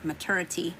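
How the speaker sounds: Southern-accented MATURITY, middle slurred toward "ter"; Southern "ma-TER-ity", said low; southern accent slip. Real, not acted. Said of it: "Here come my southern accent".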